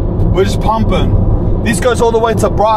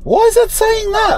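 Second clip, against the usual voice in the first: baffled. Real, not acted. Reacting to the fire warning.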